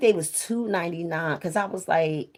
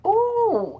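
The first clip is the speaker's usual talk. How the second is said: a high gushing "ooh" from grandma; delighted ooh; 3 dB louder than usual. Retold or imitated.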